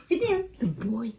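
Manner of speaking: high laugh into high-pitched praise for the dog; giggle, then coo at the dog; high, giggly praise for a pet. Real, not acted.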